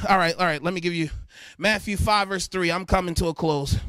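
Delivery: ordinary talk, nothing marked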